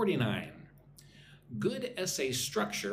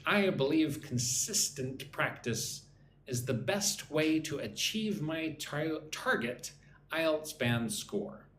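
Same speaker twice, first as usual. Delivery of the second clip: slow model read; slower than usual. Shown as a demonstration. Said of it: "Follow along with me".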